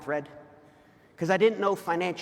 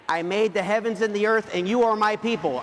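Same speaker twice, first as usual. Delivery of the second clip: scripture quote; 3 semitones higher, 5 dB louder than usual. Acted.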